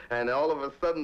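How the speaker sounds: usual speaking voice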